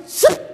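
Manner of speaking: short loud hissed squirt; spurting noise. Retold or imitated. Preacher showing blood gushing, short spurting noise. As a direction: mimic a jet of blood.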